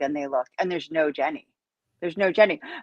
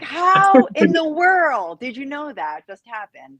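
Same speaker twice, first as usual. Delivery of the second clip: amazed; 7 semitones higher, 5 dB louder, slower than usual. Real, not acted. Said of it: "amazed at Scotty".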